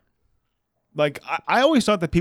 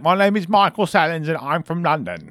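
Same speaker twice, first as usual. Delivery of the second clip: lightly put-on London accent, higher at first; London rapper impression; 5 semitones higher than usual. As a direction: mimic an old London rapper. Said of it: "he's like".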